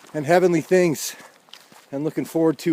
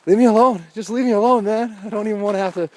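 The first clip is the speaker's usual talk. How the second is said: exasperated; 7 semitones higher, 3 dB louder, faster than usual. Retold or imitated. His exasperated inner reaction.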